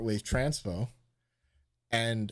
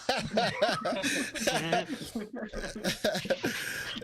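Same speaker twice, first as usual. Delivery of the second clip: long high burst of laughter; 9 semitones higher than usual. Real, not acted. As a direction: laugh it out for a while. Laughs at kidnap joke.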